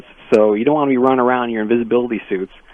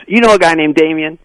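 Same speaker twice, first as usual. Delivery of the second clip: a Deliverance-style Southern caller; deep south accent; 6 semitones higher, 4 dB louder than usual. Retold or imitated. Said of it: "this guy with a thick Southern accent".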